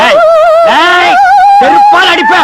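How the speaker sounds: high, angry yell: Hey, I'll hit you with my slipper; furious high-pitched threat; angry shout. Acted. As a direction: snap at a dog that is barking. Yelling at a barking noise.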